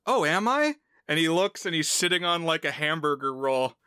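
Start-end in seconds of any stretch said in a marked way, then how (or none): 0.1-0.8 high, rising mock-innocent question; unsuspecting gamer. Gamer answering his friend's compliment, high innocent question. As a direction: lift it right up as a question. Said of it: "the guy says".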